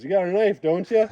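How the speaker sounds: high, loud laughing quote; laughing teasingly. Retold or imitated. Husband teasing wife who fears wolves, laughing. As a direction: toss it out through a laugh. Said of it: "starts to laugh, and he goes".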